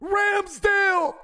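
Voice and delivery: high, stretched shout of the name; drawn-out hype call; excited reveal shout. Real, not acted. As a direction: announce him like a stadium speaker.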